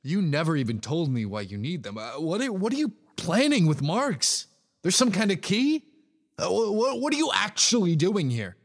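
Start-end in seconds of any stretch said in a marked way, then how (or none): 2.2-8.5 frustrated demand. Acted. Demanding answers, rising frustration.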